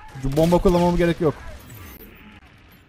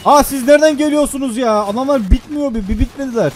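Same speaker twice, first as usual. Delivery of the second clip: usual speaking voice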